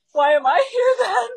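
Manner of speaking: high, loud laughing cry of disbelief; mock panic. [name] hearing the pastor story, mock panicked squeal. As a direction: squeal it, half laughing, half wailing.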